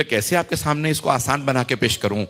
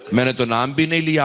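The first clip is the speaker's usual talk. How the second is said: ordinary delivery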